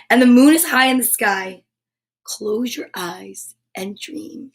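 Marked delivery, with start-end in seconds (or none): 2.2-4.5 slow, hushed bedtime close; soft dreamy reading. A narrator's reading. Reading a bedtime ending, slow and hushed. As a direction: lull them toward sleep.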